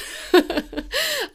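high-pitched burst of giggling; high giggle. Real, not acted. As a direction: giggle at yourself. Laughs at own pride.

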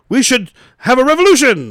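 mock rabble-rouser's shout, jumping high and loud; rallying cry. Host imitating some guy kicking off a coup, high rallying shout. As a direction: call a crowd to rise up. Acting someone rousing a crowd.

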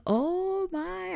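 high gasping oh my; shocked gasp. Real, not acted. Gasping at the queen's visit, high surprised oh my. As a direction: gasp then high, stunned oh my. Gasps at queen wanting Eloise.

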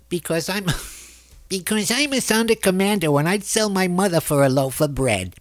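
high pinched parody voice; satirizing a radio host's hateful rant; mock Mark Levin. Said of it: "talk like Mark Levin".

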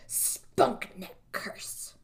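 very slow whisper; creepy hushed reveal; ominous whisper. Retold or imitated. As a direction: breathe the curse slowly. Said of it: "And he goes".

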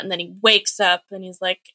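normal talking voice

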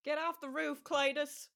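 hammed-up rural Southern accent. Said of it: "which is also the Southern".

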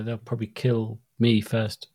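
ordinary talk, nothing marked

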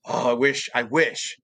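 ordinary talk, nothing marked

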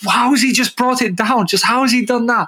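high-pitched, astonished questioning; amazed disbelief. Acted. Podcaster voicing a viewer amazed by Zidane's control, astonished disbelief. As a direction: marvel at it, voice leaping right up.

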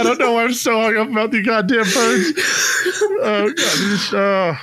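high, laughing through the words; laugh-crying. Real, not acted. Host mocking his own bird fixation, laughing through the words. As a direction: crack up mid-sentence.

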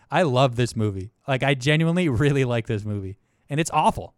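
ordinary talk, nothing marked

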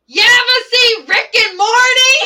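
high, blasting shouted question; full-volume mimicked kid; imitated kid's yell. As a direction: bellow it like an unfiltered schoolkid. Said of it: "yell at the top of his lungs".

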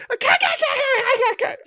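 very high, laughing cack cack cackle; imitated cackle. Trainer imitating his client's cackling laugh, very high. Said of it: "his ass starts laughing and he goes".

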